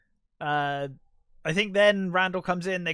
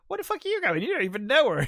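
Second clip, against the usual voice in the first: high, mocking outrage, a bit louder; incredulous outburst; 10 semitones higher, 3 dB louder, faster than usual. Randall scoffs at Dante for wanting to come: high incredulous outrage. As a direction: scoff at him, pitch jumped way up.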